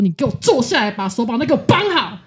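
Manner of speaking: a retold stern order, moderately loud; fierce order. Boyfriend ordering her to sit and wrap her hands, sternly. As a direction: coach barking at his girlfriend. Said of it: "他就很凶的就指着我说".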